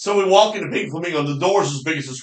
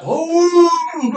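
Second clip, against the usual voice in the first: high, loud cry of triumph; imitated excited whoop; 6 dB louder than usual. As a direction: holler it like a hunter who hit. Retelling hunter's whoop again.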